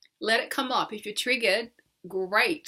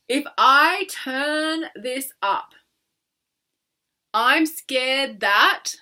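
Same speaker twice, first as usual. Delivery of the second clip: very slow, louder; 3 semitones higher, 7 dB louder, much slower than usual. Shown as a demonstration. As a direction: stretch every word. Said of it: "first thing that comes to mind".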